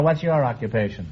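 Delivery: normal talking voice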